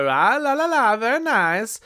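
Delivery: sing-song Borat, stretch out "very nice"; Borat impression. Quoting Borat to viewers, hammed-up Borat accent. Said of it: "without hearing".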